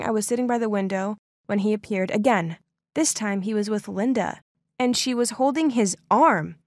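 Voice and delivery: ordinary delivery, nothing marked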